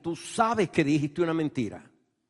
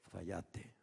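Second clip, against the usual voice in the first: hushed, low and soft; soft voice of God; 9 semitones lower, 16 dB quieter than usual. Acted. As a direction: say it gently like a quiet inner voice. Voz suave del Espíritu Santo. (voz suave y baja, casi en susurro)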